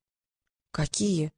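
soft, timid question. Acted. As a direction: barely dare to ask. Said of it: "робко спросил Трикс".

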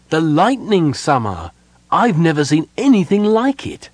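high, loud thrill; ecstatic. A narrator's reading. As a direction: cry out in wonder at the storm. Prince in ecstasy over the storm.

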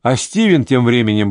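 plain reading voice

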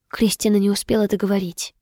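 usual speaking voice

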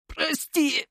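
very high, squeezed cry; acted high-pitched weeping plea; choked tearful apology. A narrator's reading. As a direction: force it out on the edge of tears. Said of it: "выдавил он из себя и заплакал".

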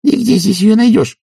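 very high servant woman's voice; high-pitched female character; protesting maid. A narrator's reading. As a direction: squeak out a baffled objection. Maid protests absurd order.

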